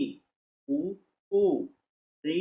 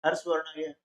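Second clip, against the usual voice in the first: ordinary delivery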